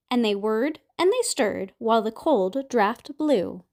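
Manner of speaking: ordinary delivery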